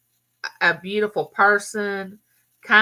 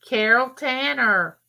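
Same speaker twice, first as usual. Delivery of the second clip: usual speaking voice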